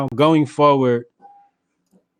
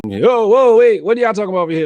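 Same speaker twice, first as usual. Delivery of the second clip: louder, rushed mock alarm, higher; hurried mock alarm cutting in; alarmed intervention; 8 semitones higher, 6 dB louder, faster than usual. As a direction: jump in fast to break it up. Retold Brandon breaking it up.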